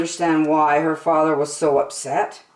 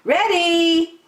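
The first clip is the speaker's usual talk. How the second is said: high, louder call across the water; 13 semitones higher, 5 dB louder than usual. A narrator's reading. Ariel calling out to Flounder that she's ready. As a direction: sing out to a friend far off. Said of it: "she called to Flounder".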